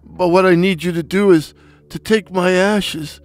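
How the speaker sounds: high, wheezy deathbed request; wheezing. Acted. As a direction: wheeze out your last wishes. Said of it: "he wheezed".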